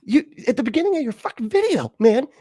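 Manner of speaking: loud, high-pitched outraged shout; exasperated yelling. Real, not acted. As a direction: yell back at the video in exasperation. Exasperated yelling back at the video.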